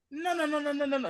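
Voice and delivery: high-pitched panicked no's; denial. Retold or imitated. Son hearing the stroke news, high panicked denial. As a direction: refuse to believe it, voice jumping up. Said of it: "He was like".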